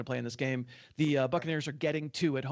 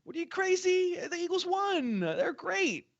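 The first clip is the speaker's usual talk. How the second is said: much higher, gushing mock fan voice; hammed-up homer fan, pitched way up; mock Eagles fan; slower than usual. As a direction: play a giddy fan who thinks the team is great. Mocking delighted Eagles fans.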